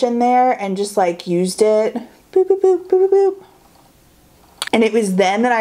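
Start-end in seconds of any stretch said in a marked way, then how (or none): 2.3-3.4 bouncy high boop sound effects, tapping the brush; high playful boops. Shown as a demonstration. Showing viewers how she dabbed her brush, high cute boops. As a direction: sing the boops up high like a cartoon.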